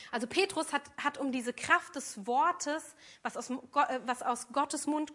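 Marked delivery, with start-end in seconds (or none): none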